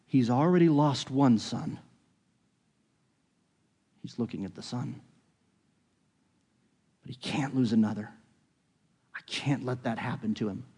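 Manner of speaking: soft, hushed pleading; quiet plea. Acted. Judah to Joseph, pleading softly for his father. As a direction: plead gently, almost breaking off.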